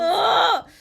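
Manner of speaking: very loud, high wail of frustration; anguished groan. Real, not acted. Host despairing over a favorite character leaving, anguished groan. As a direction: groan it out at full volume.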